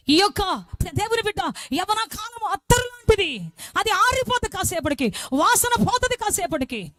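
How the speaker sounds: rising sermon fervour; full-force preaching. Acted. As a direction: preach it out to the hall.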